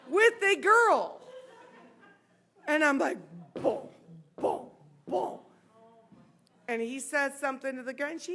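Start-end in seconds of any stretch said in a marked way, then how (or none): 3.5-5.4 three spaced, popped booms; imitated heartbeat thuds. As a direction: mimic a pounding heart, beat by beat. Imitates her pounding heart.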